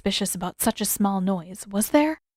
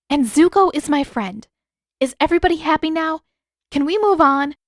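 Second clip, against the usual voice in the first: exasperated; 7 semitones higher, 6 dB louder than usual. A narrator's reading. Katara exasperated introductions.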